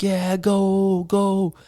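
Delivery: high, drawn-out crowd cheer; imitated cheering. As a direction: cheer on a runner, pitched high without shouting. Drinkers cheer on passing runner.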